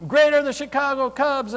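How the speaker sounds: high, loud mock stadium chant; imitating yelling fans. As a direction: yell it like rallying Cubs fans. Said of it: "Start yelling".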